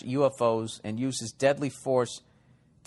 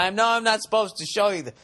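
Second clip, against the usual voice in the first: higher, louder first go at a bad impression; half-formed presidential impression, pitched up; Nixon; 7 semitones higher, 3 dB louder than usual. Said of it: "That was a bad Nixon impression".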